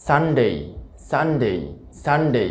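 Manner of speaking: সানডে ending in a gliding "dey"; full ei diphthong closing সানডে; correct pronunciation. Shown as a demonstration. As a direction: say সানডে as "SUN-day". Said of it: "সঠিক উচ্চারণটা".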